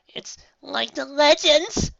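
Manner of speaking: high, slow frightened murmur; scared pony whimpering. A narrator's reading. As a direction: shrink back in fear. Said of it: "whimpered Page Turner".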